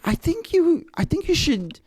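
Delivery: light impression of a friend telling him to get on YouTube; encouraging friend. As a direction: pitch it up as the friend urging you on. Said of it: "They're like".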